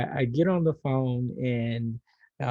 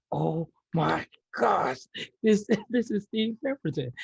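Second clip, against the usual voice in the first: stunned realization; 8 semitones higher, 3 dB louder than usual. Retold or imitated. Pharmacist realizes who is calling her, starstruck amazement. Retold moment of sudden recognition.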